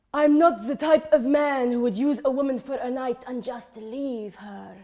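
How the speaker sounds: put-on voice of a married uncle; married uncle type. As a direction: impersonate the uncle type protesting his decency. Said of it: "and he says".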